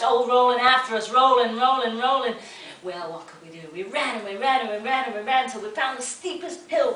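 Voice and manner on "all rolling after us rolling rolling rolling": mounting alarm. A narrator's reading. Pumpkins chasing the boys.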